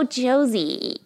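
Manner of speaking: mock American "Oh, Josie", stretched; American realisation. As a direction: imitate an American finally getting "Josie". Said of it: "And then they're like".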